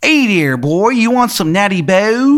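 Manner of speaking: high, loud, bad Maryland accent; terrible Maryland accent. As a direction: greet them in a hammed-up Maryland twang. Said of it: "he's got, like, a terrible Maryland accent".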